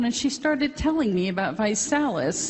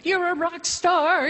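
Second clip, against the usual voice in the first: quoting the doctor's excitement, pitched much higher; excited doctor; 10 semitones higher than usual. Retold or imitated. Doctor rushing in with her results, mock excited praise. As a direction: gush it like the thrilled doctor. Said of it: "He came running into the office".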